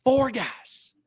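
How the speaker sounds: a hint of the boss harping on it; mock insistence. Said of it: "He just kept saying".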